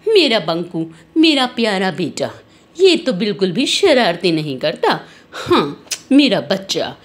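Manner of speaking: put-on low, cooing praise of her boy; doting mother. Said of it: "Banku को प्यार करने लग जाती है".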